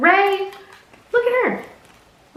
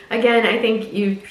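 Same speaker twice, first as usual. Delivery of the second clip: ordinary talk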